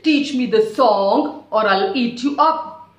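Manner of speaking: loud mock threat; bully the little bird; threatening demand.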